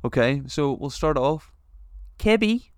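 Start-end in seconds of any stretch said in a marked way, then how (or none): 2.2-2.7 put-on light Australian accent on Cabby, a bit higher; a touch of Australian accent. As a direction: say Cabby like an Aussie. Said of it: "say these in an Australian accent".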